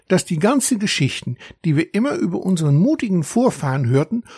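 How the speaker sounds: a hint of a proud little dragon explaining; young dragon. Young dragon telling the narrator about his uncle, lightly voiced.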